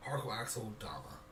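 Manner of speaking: ordinary talk, nothing marked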